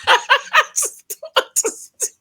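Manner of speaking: high, slow laugh through the words; laughing disbelief. Real, not acted. Vlogger baffled by a show breakup, laughing high and slow. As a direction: laugh in exasperation.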